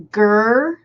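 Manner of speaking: "grr" drawn out as a single stressed beat; scansion downbeat. Shown as a demonstration. Teacher showing students the downbeat, stressed and drawn out. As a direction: hold "grr" long like a downbeat. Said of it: "when you feel that, like, downbeat".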